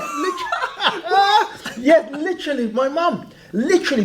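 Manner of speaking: high-pitched laughing. Real, not acted. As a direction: laugh through the words. Laughing at mum reveal.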